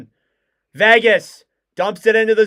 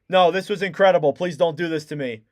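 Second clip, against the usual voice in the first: usual speaking voice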